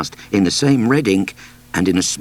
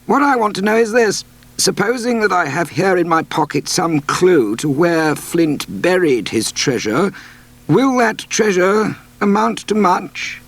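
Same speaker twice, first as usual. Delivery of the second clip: higher, careful doctor voice, slowing to ask a shrewd question; doctor's voice; 11 semitones higher than usual. Acted. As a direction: play the measured, clever doctor, pitched up. Doctor's voice in story.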